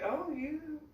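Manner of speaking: a hint of the cashier being amazed; cashier's voice. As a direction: lightly play the impressed cashier. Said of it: "the cashier looks at me and she's like".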